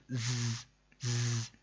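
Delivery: ز as a bare, sustained buzzing z; sharp whistling Z. Shown as a demonstration. Teacher isolates the sharp sound, sustained hissy ز. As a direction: hold ز like a buzzing whistle, no vowel. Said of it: "سیٹی کی طرح کی تیز آواز".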